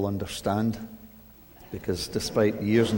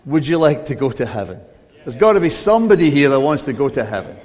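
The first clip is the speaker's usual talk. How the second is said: louder, pressing question to the room; louder and faster, urging a reply; coaxing louder; 3 semitones higher, 7 dB louder, faster than usual. Shown as a demonstration. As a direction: press them for an answer. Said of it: "maybe you should just start again".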